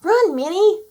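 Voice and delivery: high, loud warning to flee; urgent shout. A narrator's reading. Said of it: "shouted Moo".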